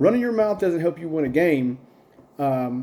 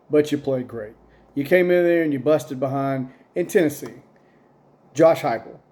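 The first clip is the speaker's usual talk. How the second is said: usual speaking voice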